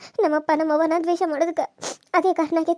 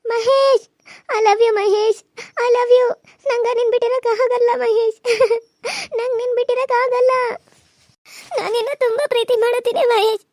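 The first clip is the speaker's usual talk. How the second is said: tearful confession; 4 semitones higher, slower than usual. Acted. Girl crying her love confession to Mahesh. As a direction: confess love through tears.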